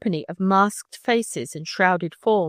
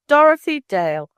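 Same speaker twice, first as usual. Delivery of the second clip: angry; 7 semitones higher, 6 dB louder than usual. Acted. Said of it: "eyes flashed in anger".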